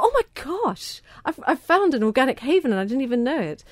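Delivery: as if you've just stumbled on a hidden treasure; overjoyed, a touch breathless; excited. Retold or imitated. Delighted thought on finding organic meat.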